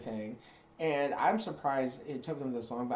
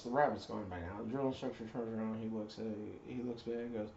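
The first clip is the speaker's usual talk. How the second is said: ordinary delivery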